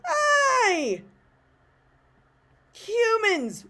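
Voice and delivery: very high, forced greeting with a long pause; awkward "ugh" greeting. Shown as a demonstration. Confused speaker trying to be inclusive, high and awkward. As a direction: squeal the greeting, then hesitate before the last word. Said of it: "you have to have the, like, ugh".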